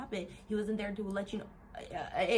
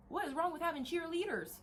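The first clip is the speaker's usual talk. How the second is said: raised-pitch repeat of the challenge; higher, insistent rhetorical question; incredulous question; 7 semitones higher than usual. Real, not acted. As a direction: push the question up high, as if baffled.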